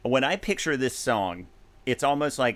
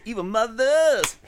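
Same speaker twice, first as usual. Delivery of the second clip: mock rock singer yelp, pitched up; 10 semitones higher, 4 dB louder, slower than usual. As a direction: mimic the singer's sassy yelp. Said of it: "he claps after Evil Mothers".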